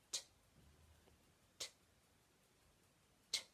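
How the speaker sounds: isolated t, tongue tip tapping the top teeth; crisp t sound. Shown as a demonstration. Teacher modelling the t sound for children, crisp and isolated. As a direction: tap out T, t, t one by one. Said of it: "To make the sound properly".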